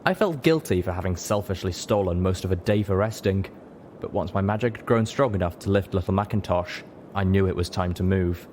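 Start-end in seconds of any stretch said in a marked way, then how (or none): none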